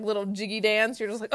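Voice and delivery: ordinary talk, nothing marked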